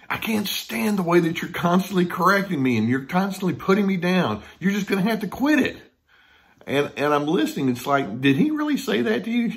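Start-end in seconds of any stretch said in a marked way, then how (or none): 0.1-5.8 indignant husband. Retold or imitated. Retold husband's complaint. 8.2-9.5 disbelief. Retold or imitated. Counselor's retold disbelief.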